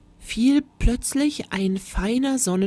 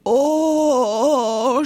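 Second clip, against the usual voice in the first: loud high awed oh; a whole class gasping in awe; children's loud amazed oh; amazed class groan; 6 semitones higher, 5 dB louder than usual. Acted. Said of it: "stöhnte die ganze zweite Klasse".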